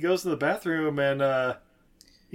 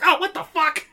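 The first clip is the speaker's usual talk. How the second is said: high startled yelp, much higher; shocked yelp; 14 semitones higher, 7 dB louder than usual. Retold or imitated. Rabbit blinded by soap, a high startled yelp. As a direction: squeal it like soap just hit your eyes.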